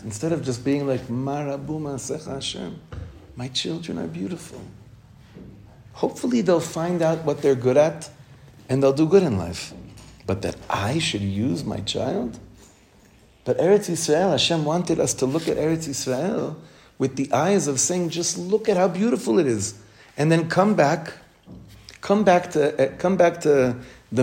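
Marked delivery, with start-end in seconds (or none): 1.1-4.6 put-on hushed and higher, a parent marvelling at their children; soft adoring wonder. Parent gazing at the children, soft and adoring. 18.1-19.7 much higher, lifted in wonder; awed delight. Acted. Rabbi urging the class to see the land, lifted in awe.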